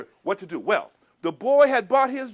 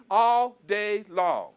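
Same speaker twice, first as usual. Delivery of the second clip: drawn out; much slower than usual. Acted. Storyteller stretching the phrase.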